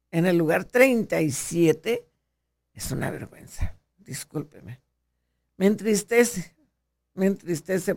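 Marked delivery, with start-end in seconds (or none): 2.7-4.8 dropped to a near whisper, ashamed; softer, whispered apology; hushed shame. Real, not acted. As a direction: say it under your breath in disgrace. Ashamed of Mexico's ranking.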